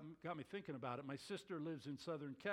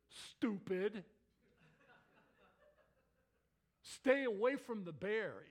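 scolding scoutmaster; 3 semitones higher, 5 dB louder, slower than usual. Retold or imitated. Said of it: "He meant it".

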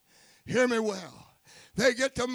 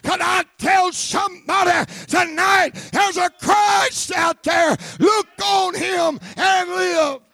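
loud, high preaching shout; full-force preaching; 7 semitones higher, 11 dB louder, slower than usual. Acted. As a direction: preach it out hard over the crowd.

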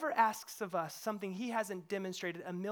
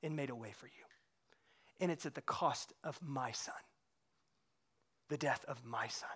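much softer, lower, grave hush; tender hushed God; 7 semitones lower, 7 dB quieter than usual. Retold or imitated. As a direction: speak it low, as God, grieving. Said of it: "God goes".

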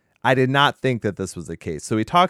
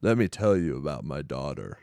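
deep, low masked villain voice; Bane voice; 6 semitones lower, 6 dB quieter than usual. Retold or imitated. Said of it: "he sounds like Bane".

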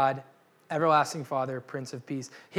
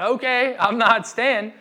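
eager bail-out; 5 semitones higher, 6 dB louder, slower than usual. Retold or imitated. Scared soldiers bail out loudly.

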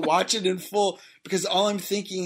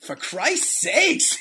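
mock Boston exasperation, drawn out and higher; Boston accent; 8 semitones higher, 3 dB louder, much slower than usual. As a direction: stretch it out in playful Boston.

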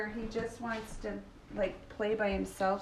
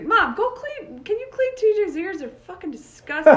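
high, loud complaining voice; disgusted son imitation; 10 semitones higher, 10 dB louder than usual. Son asking mom to clean ears, loud mock disgust. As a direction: imitate a grossed-out son nagging.

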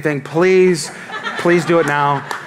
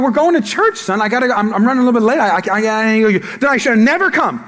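flustered dad; 6 semitones higher than usual. Retold or imitated. Dad protesting to his son about the cake pop, flustered and high. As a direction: sputter like a caught-out father. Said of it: "I'm like".

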